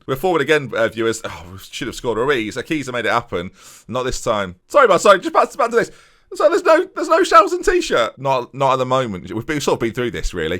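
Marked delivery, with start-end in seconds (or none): 4.7-8.2 high, loud cartoonish footballer; squeaky player voice. Striker apologises to his manager, high squeaky voice. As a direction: play the eager striker pestering his boss.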